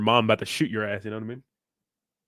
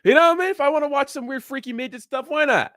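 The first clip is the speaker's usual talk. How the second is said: excited high squeal; 13 semitones higher, 7 dB louder than usual. Real, not acted. Streamer squealing to chat about his freedom, excited. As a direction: voice jumps way up and louder, gleeful.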